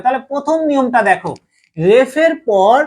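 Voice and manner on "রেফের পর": slow dictation. Shown as a demonstration. Teacher dictating the rule word by word, slowly. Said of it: "রেফের পর দ্বিত্ব বর্জন হয়".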